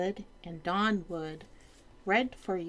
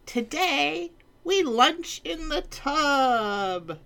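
slow, higher, proud king; grand king voice; 9 semitones higher, 4 dB louder, slower than usual. Acted. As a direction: announce it grandly and slowly. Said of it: "cried the king".

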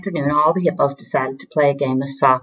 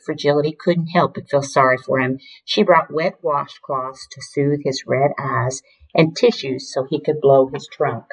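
ordinary delivery

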